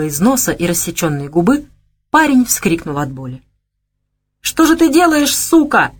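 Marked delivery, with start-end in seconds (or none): 4.4-5.9 high, loud cry of pain and rage; pained yell. A narrator's reading. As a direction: yell it out as you're struck. Man cries out in pain.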